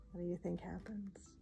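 hushed, quick whisper; whispered aside. Real, not acted. Storyteller asks the listeners what happens, whispering.

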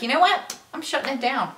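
high, put-on exasperated mom voice; fed-up mom. Playing a mom shutting everything down, fed up. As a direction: play a mom who has had enough. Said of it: "what if as moms".

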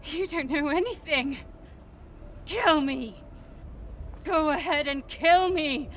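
defiant taunt. Acted.

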